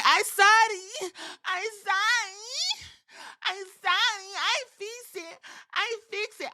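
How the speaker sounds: squeaky high timid pleading voice; high pleading Kairi. Retold or imitated. Recapping Kairi begging Asuka, tiny high pleading voice.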